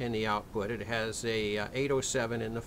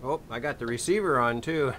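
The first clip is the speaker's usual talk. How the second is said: usual speaking voice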